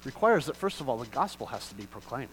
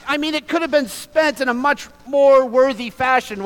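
high, loud preacher's fervor; preaching with rising intensity; impassioned preaching; 10 semitones higher, 10 dB louder than usual. Acted. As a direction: drive the story home from the pulpit. Preaching at full force.

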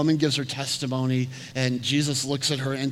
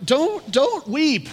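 high, slow, drawn-out comfort; sing-song high don't weep; much higher, stretched plea; 14 semitones higher, 7 dB louder, much slower than usual. Retold or imitated. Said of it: "And he's like".

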